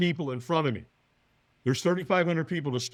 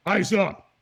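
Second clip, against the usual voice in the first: sudden louder, higher order; trainer's command; 4 semitones higher, 5 dB louder than usual. Retold or imitated. Trainer orders a frightened firewalker, sharp command. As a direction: snap it out as an instruction.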